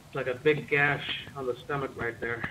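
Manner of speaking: ordinary talk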